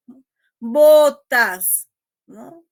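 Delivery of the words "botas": "Botas" said loud on its own, hitting the accent; stress on BOTAS. Shown as a demonstration. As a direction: isolate "Botas" and punch it loud. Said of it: "atender al acento del último componente".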